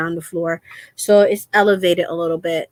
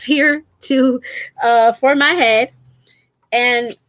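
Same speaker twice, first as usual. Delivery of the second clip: ordinary delivery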